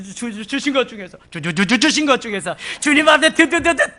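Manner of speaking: tripping over the words; stammering. Acted. Preacher voicing a believer stammering thanks to God, overwhelmed. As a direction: stutter out of overwhelmed gratitude.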